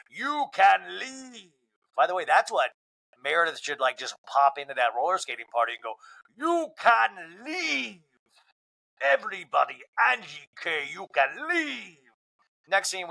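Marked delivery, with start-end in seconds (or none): 0.1-1.5 slow, stretched-out, haughty you can leave; drawn-out dismissal. Pretend. Mock dismissal at party. 6.4-8.0 slow, stretched-out, haughty you can leave; drawn-out dismissal. Pretend. Imagined Meredith at party. 9.0-12.0 slow stretched send-off naming Angie K; haughty slow goodbye; drawn-out dismissal. Pretend. Imagined Meredith at party.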